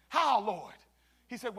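ordinary talk

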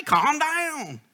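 high, mock-soothing voice; imitating son-in-law. Son-in-law Alex settling the riled-up preacher, high mock-soothing imitation. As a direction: play the calm son-in-law patting him down. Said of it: "he always has a saying".

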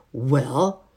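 sharp, loud questioning "Well?". A narrator's reading. Housemaid answers the children's knock, sharply. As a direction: demand it sharply, with a rising end. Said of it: "she asked sharply".

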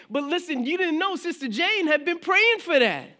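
high, loud impassioned sermon; full-force preaching. Acted. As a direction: preach it out with fire.

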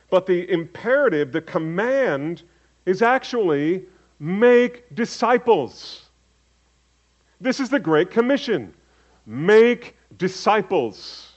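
slow, high, loud make disciples; the real command, hammered home slowly; stress on make disciples. Shown as a demonstration. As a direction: lean hard on make. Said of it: "the emphasis is not on going".

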